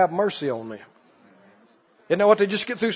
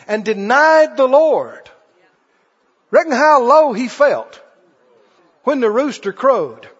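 normal talking voice

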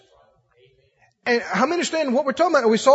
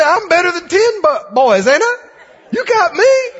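higher, louder playful boast; boasting husband; 6 semitones higher, 5 dB louder than usual. Retold or imitated. Elkanah boasting to Hannah that he is better than ten sons, playful and loud. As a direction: tease her like a proud husband.

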